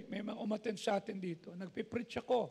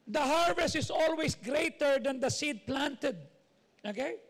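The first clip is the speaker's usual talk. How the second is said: rising, emphatic pulpit preaching, higher at the close; fervent sermon voice; 6 semitones higher, 4 dB louder than usual. Acted. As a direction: build the point to the congregation. Preaching to congregation.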